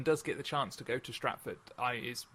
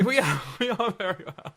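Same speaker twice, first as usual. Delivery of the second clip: laughing; 7 semitones higher, 9 dB louder than usual. Real, not acted. Laughing reply.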